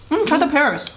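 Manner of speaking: ordinary talk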